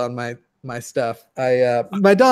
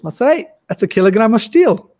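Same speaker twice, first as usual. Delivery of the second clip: higher, louder know-it-all declaration; confident sketch character; 7 semitones higher, 5 dB louder than usual. Retold or imitated. Retelling a sketch to friends, the Irish guy's smug higher verdict. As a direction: announce the wrong answer with total certainty. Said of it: "and he goes".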